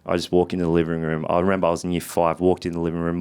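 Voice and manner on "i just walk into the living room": ordinary talk, nothing marked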